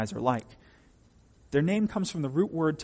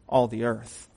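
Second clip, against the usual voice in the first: normal talking voice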